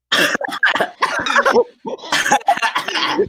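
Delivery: breathless high cackle; high laughing. Real, not acted. Big laugh.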